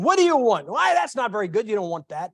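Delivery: a hint of a bossy waitress talking a customer out of it; glaring waitress voice; rude waitress. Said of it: "she would just glare at you and".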